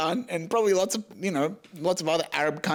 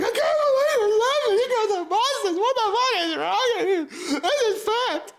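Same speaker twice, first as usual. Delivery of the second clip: put-on outraged kid. Eleven-year-old fighting with his dad, high outraged squeal. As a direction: shriek it at your dad like an appalled child. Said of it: "I'm fighting with him".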